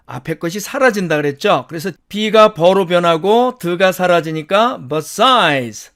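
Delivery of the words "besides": besides said slowly with the d dropped, sliding straight into the s; reduced besides. Shown as a demonstration. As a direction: drop the d in besides. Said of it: "besides 이렇게 발음될 밖에 없죠".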